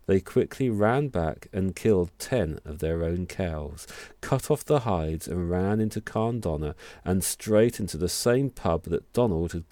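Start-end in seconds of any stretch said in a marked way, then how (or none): none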